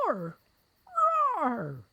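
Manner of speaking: a tiny mouse trying to roar; feeble mouse roar. A narrator's reading. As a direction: attempt a roar that comes out small.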